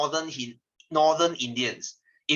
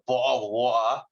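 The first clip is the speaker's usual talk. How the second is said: Cockney with the middle t sounds dropped; Cockney accent; slower than usual. Shown as a demonstration. Teacher demonstrating London accents to class, Cockney accent. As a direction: do a glottal-stopped Cockney phrase. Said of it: "that one is Cockney accent".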